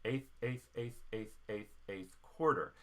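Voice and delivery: eighth, eighth, eighth, eighth, eighth, eighth quarter counted in rhythm; chanting note values in time; rhythm spoken out. Shown as a demonstration. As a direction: speak the rhythm evenly, slowing on quarter. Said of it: "we're gonna use a rhythm".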